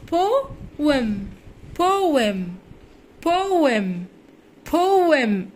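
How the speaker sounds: بوم stretched as "po-em", the e as a short "eh"; drawn-out pronunciation demo. Shown as a demonstration. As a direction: model بوم slowly as two sounds, po and em. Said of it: "والإي هننطقها بصوت الأ".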